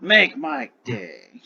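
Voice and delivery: very slow, drawn-out dare; dragon's scripted challenge. Acted. Dragon taunts the human from his booklet, slow theatrical dare. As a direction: stretch each word for theatrical menace.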